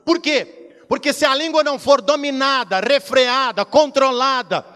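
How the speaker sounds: loud, high shouted sermon; fiery preaching. Acted. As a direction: proclaim it with full fervour. Preaching at full force. (pregação fervorosa)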